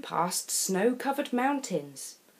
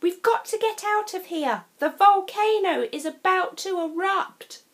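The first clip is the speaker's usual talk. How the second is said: put-on higher, louder boy's warning; urgent Tom; 10 semitones higher, 4 dB louder than usual. A narrator's reading. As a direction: press her to flee now. Tom urges Katie.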